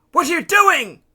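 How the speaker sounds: high, loud cry of disbelief; alarmed shout. A narrator's reading. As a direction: yell after someone swimming off. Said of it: "he shouted".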